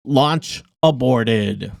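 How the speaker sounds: slow, low loudspeaker voice; PA announcement. Acted. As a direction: announce it slowly over a PA. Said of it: "The automated voice ... blares over the P A system".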